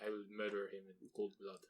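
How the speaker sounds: put-on a jokey Russian hitman accent; mock Russian assassin. Russian hitman vows revenge, mock menacing Russian accent. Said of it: "I don't know why they're Russian".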